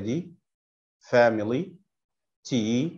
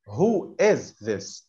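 slow model reading; much slower than usual. Shown as a demonstration. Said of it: "teacher repeats slowly for pupils".

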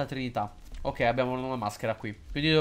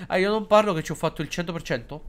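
normal talking voice

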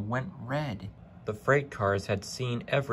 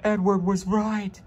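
high, loud frightened engine voice; 11 semitones higher, 6 dB louder than usual. A narrator's reading. Henry, terrified, realising Edward warned him. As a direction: squeal it like a scared little engine.

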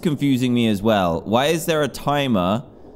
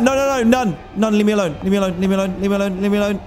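higher, louder frantic repeated pleas; frantic pleading; 7 semitones higher, 3 dB louder, much faster than usual. Real, not acted. Player fleeing the monster, pleading frantically. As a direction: beg it to leave you alone, fast.

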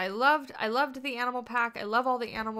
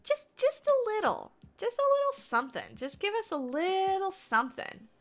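pleading squeaky voice; 9 semitones higher than usual. Pretend. Begging chat for content.

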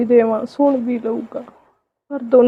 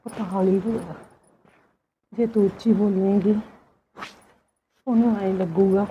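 softer, urging her to keep her voice down; hushed; 2 semitones lower, 7 dB quieter than usual. Acted. Aunt hushing her niece so the daughter-in-law won't hear, softly.

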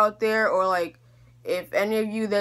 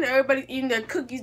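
ordinary talk

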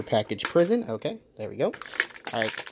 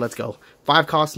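usual speaking voice

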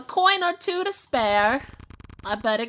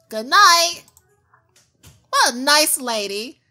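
ordinary talk, nothing marked

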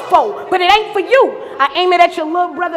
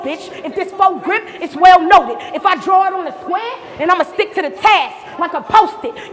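ordinary talk

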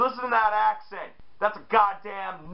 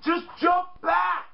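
exasperated shout; 9 semitones higher, slower than usual. Real, not acted. Frustrated advice to wrestlers.

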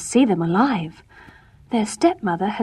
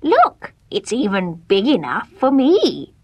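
witch voice. Acted. Witch shows the oven.

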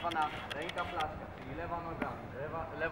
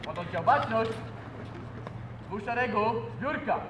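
drill command; 4 semitones higher, 5 dB louder than usual. Real, not acted.

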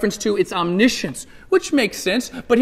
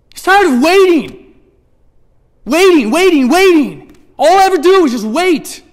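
tired of waiting; 8 semitones higher, 9 dB louder than usual. Acted. Preacher voicing John to the congregation, fed up and loud. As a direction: sigh it out like you've waited forever. Preacher voices John's weary frustration.

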